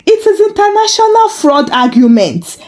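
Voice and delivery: plain reading voice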